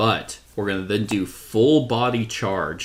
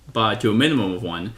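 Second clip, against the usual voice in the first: normal talking voice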